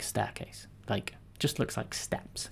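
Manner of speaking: slow breathy whisper; whispered aside. Real, not acted. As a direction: drop to a slow whisper, like sharing a secret. Quiet aside explaining chart.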